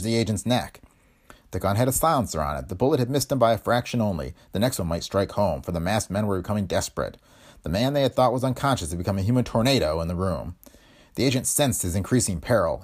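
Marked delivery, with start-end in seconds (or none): none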